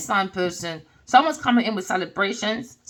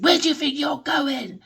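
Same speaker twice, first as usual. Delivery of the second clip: angry pastor; 5 semitones higher than usual. Retold or imitated. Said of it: "He was angry".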